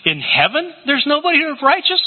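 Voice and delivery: high cracking plea of a stunned mourner; incredulous John. Retold or imitated. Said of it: "he begins to cry".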